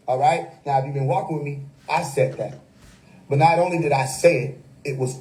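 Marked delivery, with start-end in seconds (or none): none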